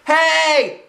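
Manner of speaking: put-on high, loud childish yell; startled kid holler. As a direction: shout it out from under a dress. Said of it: "I hollered".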